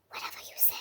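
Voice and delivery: breathy, barely there whisper; dismissive whisper. Real, not acted. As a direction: brush it off in a whisper. Dismissing a hater.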